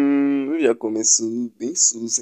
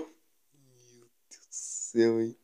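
whisper; 2 semitones lower, 13 dB quieter than usual. Real, not acted. Hiding from monster.

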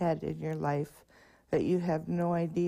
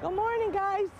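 cheerful greeting called out. Real, not acted. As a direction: sing out a hello across the street. Greeting kids at crossing.

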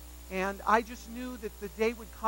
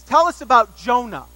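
professor's demand; 3 semitones higher, 16 dB louder than usual. Retold or imitated. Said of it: "he says".

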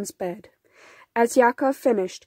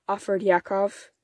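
ordinary delivery

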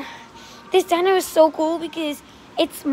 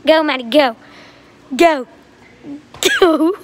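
cheering; 5 dB louder than usual. Real, not acted. Cheering sister on running.

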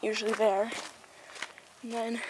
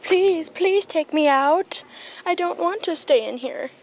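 high, louder begging voice, drawn out; pleading horse; 7 semitones higher, 4 dB louder, slower than usual. Acted. Voicing a horse stuck in its stall, high pleading. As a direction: beg to be let out, stretching the pleas. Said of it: "You're like".

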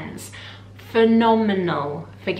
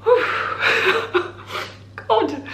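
laughing through tears; 12 semitones higher, 4 dB louder than usual. Real, not acted. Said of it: "I'm a very emotional person".

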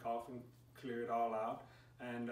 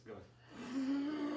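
Bigfoot breath; 14 semitones higher than usual. Shown as a demonstration. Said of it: "it's gonna sound like something like this".

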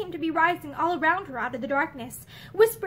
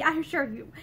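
ordinary delivery, nothing marked

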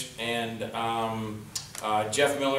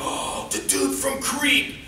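high, drawn-out gush of recognition; starstruck fan; 12 semitones higher, slower than usual. Retold or imitated. Fan spotting the actor at gas station, mock starstruck. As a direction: gush slowly like an imitated fan.

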